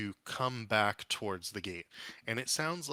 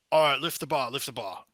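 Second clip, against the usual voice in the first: loud call from behind the gate, higher; guard calling to others behind the gate, loud; shouted order; 4 semitones higher, 8 dB louder than usual. Acted. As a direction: bark the order to lift the bar.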